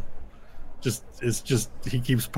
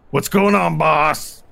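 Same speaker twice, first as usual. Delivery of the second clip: higher and louder, a henchman checking on his boss; gruff henchman call; 5 semitones higher, 8 dB louder, slower than usual. Acted. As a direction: call out to the boss, rough and loud. Orc companion calls to boss.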